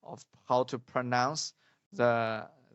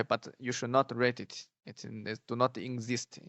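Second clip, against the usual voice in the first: normal talking voice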